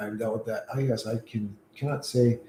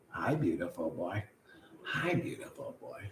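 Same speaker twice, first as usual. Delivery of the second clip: soft, hushed hello to the dog; tender greeting; 6 semitones lower, 14 dB quieter than usual. Real, not acted. Greeting the dog at his feet, hushed and tender. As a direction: greet him gently, barely voiced.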